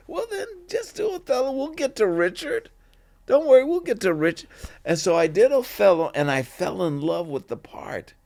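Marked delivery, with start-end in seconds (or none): 0.1-4.5 high-pitched placating imitation; airy, hurried reassurance in a raised voice; coaxing teachers. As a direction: mimic teachers brushing off his objection, quick and high. Imitates teachers coaxing him into Othello.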